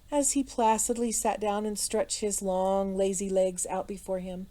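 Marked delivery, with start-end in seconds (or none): none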